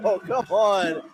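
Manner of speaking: high, stretched-out hopeful cheer; excited cheering. Real, not acted. Co-announcer reacts to a chance to tie the game, excited cheering. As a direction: urge the tying shot on.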